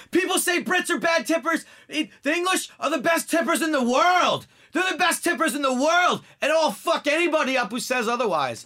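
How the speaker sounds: hammed-up furious rant; ranting shout. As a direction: bellow it like a drunk picking a fight. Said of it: "he just goes on this tirade".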